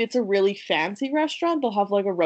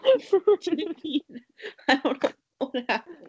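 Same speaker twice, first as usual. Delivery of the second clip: laughing whisper; 6 semitones higher, much slower than usual. Real, not acted. Giggling after voice cracked.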